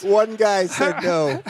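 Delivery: said through laughter, loud and slow; laughing. Real, not acted. Host teasing the guest about the ban, laughing. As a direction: let the laugh spill over the words.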